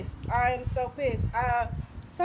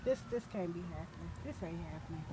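very soft and low; real hushed shock; stunned disbelief; 8 semitones lower, 10 dB quieter, faster than usual. Real, not acted. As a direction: say it to yourself, barely voiced. Disbelief at missing car.

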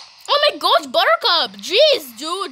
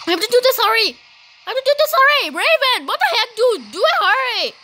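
urgent; 7 semitones higher, 4 dB louder, faster than usual. Real, not acted. Urging the game partner.

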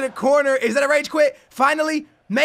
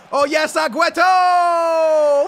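loud, drawn-out celebration; long triumphant goal cry; excited goal shout; 3 semitones higher, 5 dB louder, slower than usual. Real, not acted. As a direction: yell it and stretch the name.

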